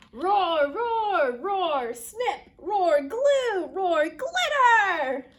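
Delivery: loud roars between high action words; rowdy, drawn-out last word; dinosaur roaring. Acted. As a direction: roar through the craft frenzy. Dinosaur roars while crafting.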